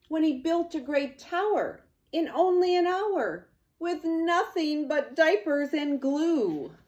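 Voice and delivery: bright, loud storybook narration, up high; lively read-aloud. A narrator's reading. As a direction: read it like an excited storyteller to kids.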